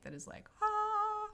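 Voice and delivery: high, slow sigh of awe; blissful ah. Real, not acted. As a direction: drift up into wonder on the ah. Describing triangle of light.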